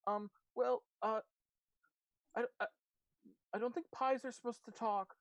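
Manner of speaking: high-pitched, hurried, nervous explaining; squeaky and anxious, tripping into hesitant stops; Blackberry, flustered. Acted. Blackberry explains the pie disaster to friends, flustered and high.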